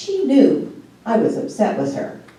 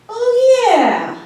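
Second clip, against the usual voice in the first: ordinary talk, nothing marked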